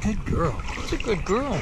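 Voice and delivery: praise dog. Real, not acted.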